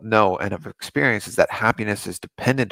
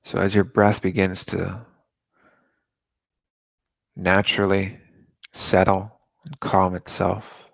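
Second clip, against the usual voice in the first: guided meditation. Acted. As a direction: ease them into calm breathing, unhurried.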